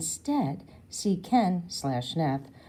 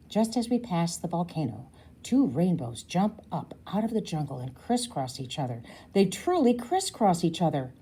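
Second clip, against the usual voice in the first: ordinary delivery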